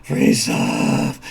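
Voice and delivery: low, drawn-out croaking zombie groan; zombie moan. Acted. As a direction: groan it slowly like the walking dead. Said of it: "they're all gonna be saying".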